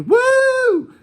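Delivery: high, loud whoop; a sudden high spring break yell; party whoop. Retold or imitated.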